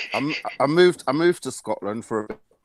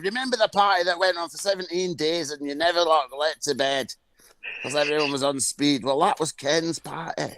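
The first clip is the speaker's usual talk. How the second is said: higher, rambling mock local; imitated Stirling accent; 4 semitones higher than usual. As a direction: babble like a Stirling local obsessed with "ken". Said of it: "people were coming up to me going".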